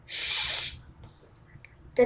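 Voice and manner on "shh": a hushing hiss to keep things quiet; urgent shush. Acted. Princess hushing the frog at the door, urgent shush. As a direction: shush someone so nobody hears.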